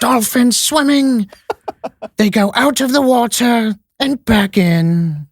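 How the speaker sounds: high, slow nature documentary narrator; hushed wonder of a wildlife film voice; David Attenborough impression. Friend imitates the nature documentary narrator, slow and high. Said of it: "he narrates all the B B C movies".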